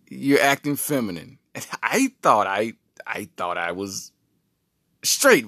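sassy wife. Retold or imitated. Wife voiced, insulting husband.

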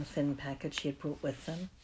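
ordinary delivery, nothing marked

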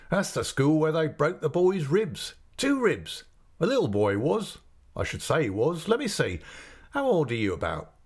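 put-on a friendly innkeeper's man coaxing the boy; chatty waiter. A narrator's reading. Waiter at the inn teasing the boy about the ale, lightly voiced.

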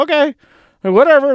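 high, light and carefree, brushing it off; breezy okay whatever. Retold or imitated. To the cashier, smiling it off with a high breezy lilt. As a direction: shrug it off with a bright smile. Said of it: "put a smile on my face and just go".

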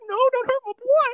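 high pleading falsetto; Malik pleading. Retold or imitated. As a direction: beg for your boy in a squeaky high voice. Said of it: "Malik's like".

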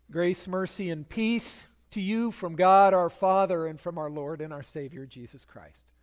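ordinary talk, nothing marked

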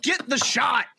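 high, drawn-out pundit rant; Stephen A. Acted. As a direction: call someone out like a ranting sports pundit. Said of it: "in my Stephen A voice".

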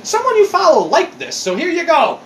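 mock Twitter voice. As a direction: play the cheerful notification. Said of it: "Twitter's just like".

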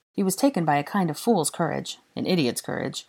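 usual speaking voice

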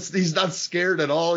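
usual speaking voice

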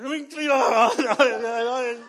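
drawn-out, grudging laugh; sarcastic slow resignation; reluctant, slow laughing. Retold or imitated. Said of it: "And I'm going".

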